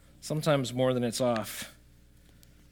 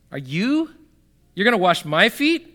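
higher, rising disbelief on "my feet"; incredulous question; 6 semitones higher, 5 dB louder than usual. Acted. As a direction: act out Peter balking at the offer. Replaying Peter's question in disbelief.

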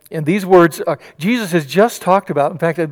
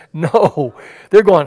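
normal talking voice

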